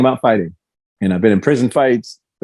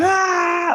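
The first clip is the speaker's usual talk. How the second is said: retold pain scream. Fighter re-enacting his knee being wrenched, retold scream. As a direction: imitate a drawn-out scream of pain. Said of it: "just literally screaming".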